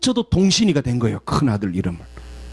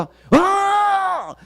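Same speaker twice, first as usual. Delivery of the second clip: uncle's hearty answer; 11 semitones higher, much slower than usual. Retold or imitated. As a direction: brush it off with a booming no-worries. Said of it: "물어보니까".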